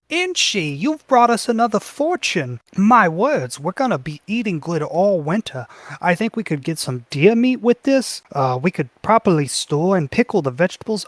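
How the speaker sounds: high, doting motherly gush; goblin mother voice. Acted. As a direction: fuss over her son like a proud mom. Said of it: "And she says".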